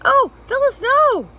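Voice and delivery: very high, loud despairing wail; husband begging his wife not to make him do it; squeaky, despairing. A narrator's reading. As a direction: plead in a squeaky, desperate voice. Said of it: "in a squeaky, despairing voice".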